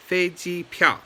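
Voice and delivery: "飞机票" with two high level syllables, then a sharp fall; first, first, fourth tone. Shown as a demonstration. Teacher giving learners a bonus word, "飞机票" in careful tones. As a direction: hold "飞机" high and level, drop "票". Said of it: "first tone, first tone, fourth tone".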